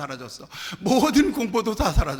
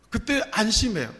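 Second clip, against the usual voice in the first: usual speaking voice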